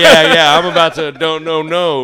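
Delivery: high, loud and fired up; heated outburst. Real, not acted. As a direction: get worked up defending him. Heated defending the kid.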